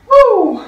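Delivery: high loud whoop; excited whoop. Real, not acted. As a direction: let out a big energetic whoop. Coach whoops mid workout.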